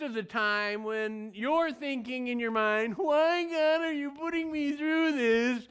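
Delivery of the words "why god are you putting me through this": mock a whiny complaint to God; whining. Preacher imitates a believer complaining to God, whining. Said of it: "it's whining".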